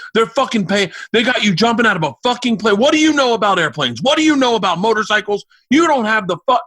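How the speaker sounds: high, loud imitated tirade; furious dad. Dad on the phone to son about skydiving, furious. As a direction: hammer out outraged questions as a ranting father, pitch climbing.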